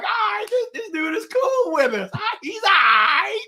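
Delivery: a high, mock-hyped voice of the older kids, shouting at the end; hamming up the big kids' shouted approval; older kids approving. Older kids accepting the sixth grader onto the court, in a high, mock-hyped shout. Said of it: "They're like".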